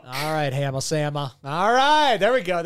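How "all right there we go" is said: higher, louder hype; celebrating the new nickname; excited cheer. Real, not acted. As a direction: cheer it like a crowd.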